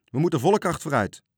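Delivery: loud, high shouted command; calling out orders. A narrator's reading. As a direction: shout orders across the deck. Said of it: "riep hij".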